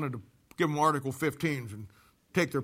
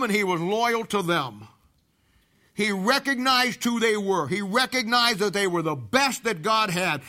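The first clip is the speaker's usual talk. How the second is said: higher, slower sermon emphasis; preacher stressing loyalty to the congregation, slow and higher; emphatic preaching; 6 semitones higher, 6 dB louder than usual. Acted. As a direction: press the point home, slowly. Preacher drives home the point on loyalty.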